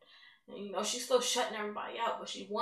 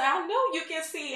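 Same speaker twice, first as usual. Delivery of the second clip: high, loud, ecstatic; joyful shout; 8 semitones higher, 8 dB louder than usual. Retold or imitated. Daughter celebrating her mother's healing, shouting with joy. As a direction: yell it while jumping up and down.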